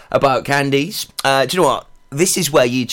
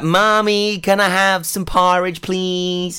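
put-on high, childish American twang asking for porridge; imitating American child accent; 7 semitones higher, much slower than usual. Dad imitating his daughter asking mum in an American accent. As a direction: mimic a little girl's American accent. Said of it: "This morning she'll be like".

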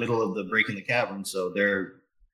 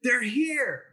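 high-pitched shout of warning; alarmed yell. Acted. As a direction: call out to a friend in alarm, voice jumping up high. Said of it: "and he yells".

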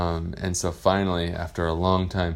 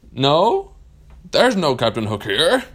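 higher, louder hammed-up denial; Captain Hook lying; 7 semitones higher, 7 dB louder than usual. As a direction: bluff badly from behind the rock. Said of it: "he said".

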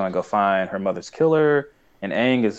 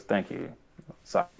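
grudging, softer, lower thanks; disgruntled; 3 semitones lower, 8 dB quieter than usual. Retold or imitated. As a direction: mutter it a bit sulky. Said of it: "in a disgruntled kind of way".

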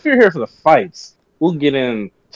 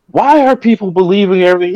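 louder, higher incredulous question; exasperated; 5 semitones higher, 3 dB louder than usual. Retold or imitated. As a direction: vent disbelief at gullible people. Acting out his own exasperated reaction.

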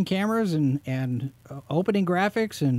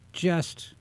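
ordinary talk, nothing marked

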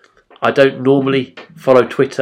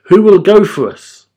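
high, loud appeal rising in force; calling question; 7 semitones higher, 7 dB louder than usual. A narrator's reading. The Lord seeking a messenger, calling out the question. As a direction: ask it out loud to a whole assembly. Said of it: "Then I heard the Lord asking".

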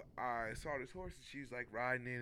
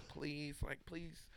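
soft pleading; 3 semitones higher, 10 dB quieter, much slower than usual. Retold or imitated. Quoting the rider's plea.

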